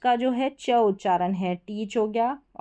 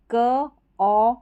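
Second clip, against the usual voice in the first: very slow, split into क, ओ and ट; sounded out; 2 semitones higher, 4 dB louder, much slower than usual. Shown as a demonstration. Teacher sounding out कॉट for students very slowly. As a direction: stretch each sound apart. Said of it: "अब यहाँ पर क्या है फ़र्क".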